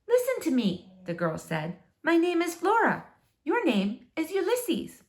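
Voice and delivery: put-on a girl's voice, gently insistent; lightly voiced girl. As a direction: introduce yourself to a squirrel. Flora voiced, higher.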